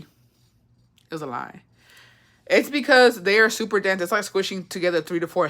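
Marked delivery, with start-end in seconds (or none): 0.0-1.6 quiet. Real, not acted. Slow soft repeat.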